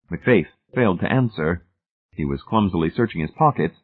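ordinary delivery

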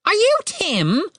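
high, demanding bird squawk; raven voice. A narrator's reading. Raven asks Tim who he is, squawking. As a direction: question him as a screechy bird.

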